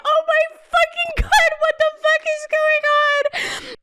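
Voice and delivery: high squealed disbelief; scandalized high-pitched outburst; retold shock. Retold shock at the mom's scolding.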